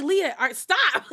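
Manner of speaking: high, loud self-scolding to hold back tears; half-laughing plea to stop welling up; telling herself to stop. Retold or imitated. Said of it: "I was like".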